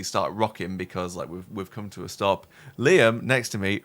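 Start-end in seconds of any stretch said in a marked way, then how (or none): none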